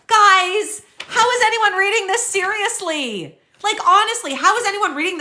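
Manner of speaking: fired up and disbelieving, pleading with the viewers; exasperated. Real, not acted. Fired-up rant at the article.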